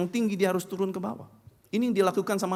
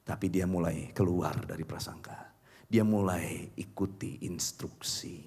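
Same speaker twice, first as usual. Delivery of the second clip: low whispered narration; narrated whisper; 10 semitones lower, 6 dB quieter than usual. A narrator's reading. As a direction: whisper the turning point. Dramatic turn in the story.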